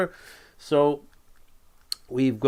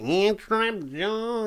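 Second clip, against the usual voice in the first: high, drawn-out cartoon hero voice; He-Man; 8 semitones higher, slower than usual. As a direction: squeeze out a slow, high, put-on voice. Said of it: "This is a bit of He-Man".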